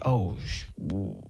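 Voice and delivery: low, hushed dread; mock dread. Daughter wilting under her father's look, hushed mock dread. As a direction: trail off softly, as if caught out. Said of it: "All my dad would have to do was look at me".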